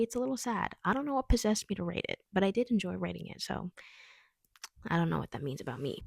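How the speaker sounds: ordinary talk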